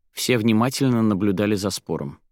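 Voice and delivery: ordinary delivery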